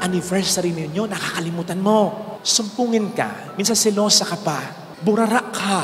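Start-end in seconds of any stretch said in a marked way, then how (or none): none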